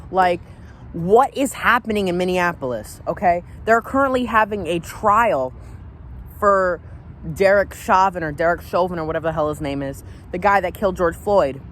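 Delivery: rising, heated anger; mad. Real, not acted. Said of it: "this makes me mad".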